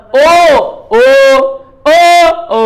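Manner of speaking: ओ repeated loud and long with rounded lips; demo of ओ. Shown as a demonstration. Teacher drills ओ for the class, loud. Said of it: "देख लो ए कहाँ आ रहा है".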